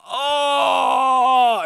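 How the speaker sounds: high, loud pained oh; a wounded yelp of disbelief; shocked cry. Real, not acted. Fan reacting to a favorite rapper, shocked shout.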